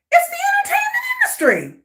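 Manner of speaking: very high, fast squeal of disbelief; exasperated outburst. Real, not acted. Vlogger unsurprised by an industry scandal, exasperated. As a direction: cry it out high and quick, as if it's obvious.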